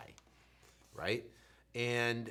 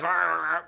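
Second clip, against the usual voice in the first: garbled blurt; 5 semitones higher, 11 dB louder than usual. Retold or imitated. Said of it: "it didn't come out like that".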